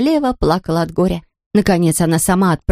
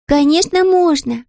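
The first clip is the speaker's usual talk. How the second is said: put-on high child voice; 10 semitones higher, slower than usual. Princess answers.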